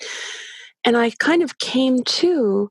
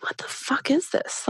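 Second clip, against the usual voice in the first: hushed, disbelieving whisper; a stunned inner whisper; incredulous whisper; 5 semitones higher, 7 dB quieter than usual. Retold or imitated. Inner voice during the birth.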